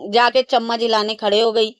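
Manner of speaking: ordinary talk, nothing marked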